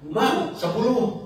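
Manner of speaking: high, drawn-out calling out; high-pitched mock yell to the seller; imitated car-window shout. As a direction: mimic a customer hailing a vendor from a car. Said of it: "Teriak dari mobilnya kan".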